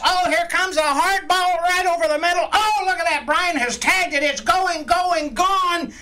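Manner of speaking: shouting it high and excited; home run call. Pretend. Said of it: "your best baseball announcer voice".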